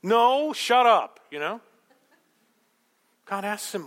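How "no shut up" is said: high, loud snapped comeback; mock angry retort. Angry person to calm questioner, mock angry shout. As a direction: bite back like a sulking hothead.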